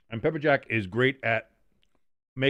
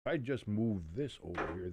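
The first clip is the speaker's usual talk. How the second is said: normal talking voice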